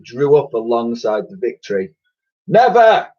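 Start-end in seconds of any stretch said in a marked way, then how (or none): 2.5-3.1 loud, high defiant yell; old soldiers' defiant cry; defiant chorus shout. Acted. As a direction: shout it back as one. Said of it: "came a chorus".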